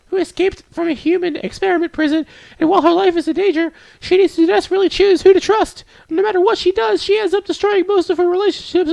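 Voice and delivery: very high falsetto storytelling; squeaky-high character voice; earnest bookish girl. Acted. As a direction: explain the plot as a shy girl, way up in falsetto. Voices Yuri describing the book.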